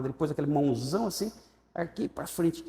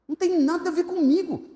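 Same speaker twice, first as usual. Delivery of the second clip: emphatic reply; 4 dB louder than usual. Retold or imitated. His defiant answer to mother.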